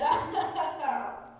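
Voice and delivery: loud hammed-up villain laugh; evil cackle. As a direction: let out a triumphant mad-scientist cackle. Villain gloats over his plan.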